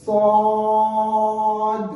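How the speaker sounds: slow, drawn-out melodic chant; Quran recitation. Shown as a demonstration. Teacher reciting letters for students, slow melodic chant. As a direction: stretch each letter name like tajweed recitation. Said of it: "बड़ी मद है".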